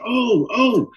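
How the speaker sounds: high, fluttering "oh, oh"; flamboyant dismay. Retold or imitated. Hairdresser Alice picking at the hair, high theatrical gasps. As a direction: recoil from the hair in mock horror. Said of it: "very flamboyant".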